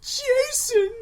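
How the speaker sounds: very high, loud mock-child squeal; crying kid voice. Imitated kid answering "what's your name", high loud mock wail. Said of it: "what's your name, kid".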